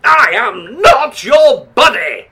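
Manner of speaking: righteous angel snapping at the demon; indignant shout. Acted. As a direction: bark it back at him, offended. Angel rejects the demon's chumminess.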